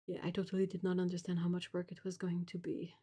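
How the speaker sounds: breathy whispered admission; whispering. Real, not acted. Painter whispers to chat about losing weight, hushed. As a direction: confide it in a whisper.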